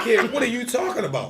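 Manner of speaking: high, loud, incredulous; what are you on about; exasperated. Retold or imitated. Retold exasperated reply to wife.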